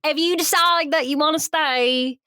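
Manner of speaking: hammed-up British accent; put-on British accent. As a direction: mimic a British suitor's accent. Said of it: "stop with the accent".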